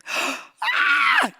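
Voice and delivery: high squeal of surprise; delighted gasp. Retold or imitated. Hearing the price, a squeal of delight. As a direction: shriek with joy. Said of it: "gasps, excited".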